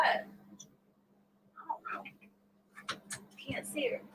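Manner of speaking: breathy whisper, barely voiced. Real, not acted. Hiding from her husband, whispering so he can't find her. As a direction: hush it so nobody hears.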